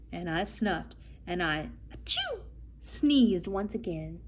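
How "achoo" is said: high sneeze burst; big sneeze. Acted. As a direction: let out a sudden sneeze. Wolf sneezes again.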